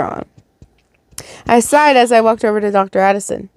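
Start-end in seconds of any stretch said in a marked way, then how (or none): none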